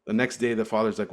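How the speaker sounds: ordinary talk, nothing marked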